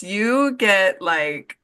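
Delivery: normal talking voice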